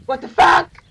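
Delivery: loud, high shocked outburst; real shocked outcry; shocked shout. Real, not acted. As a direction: blurt it out in disbelief. Shocked by the video.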